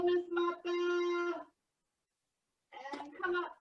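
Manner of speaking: high, slow, soft coaching voice; guided stretching. Acted. Leads stretching for students.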